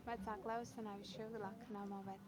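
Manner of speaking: ordinary delivery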